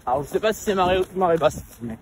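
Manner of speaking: talking mid-jog, a bit out of breath; while running. Real, not acted. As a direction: speak between strides as you run. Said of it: "on est en train de courir".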